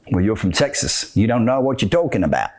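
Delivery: low-pitched, badly faked Australian accent; attempted Australian accent. Mocking someone from Texas, bad Australian accent. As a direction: put on a shaky-quality Australian accent. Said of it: "supposed to be an Australian accent".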